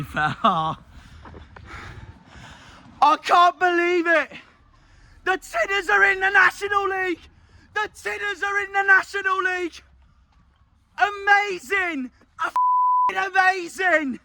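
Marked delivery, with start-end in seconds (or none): 3.2-14.2 elated. Real, not acted. Fan celebrating the Tinners' promotion, elated shouting. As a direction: proclaim it to the world.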